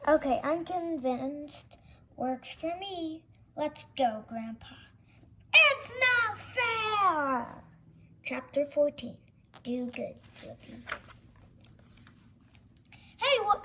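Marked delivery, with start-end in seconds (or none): 5.5-7.6 slow whiny wail. Acted. Defeated villain wails again.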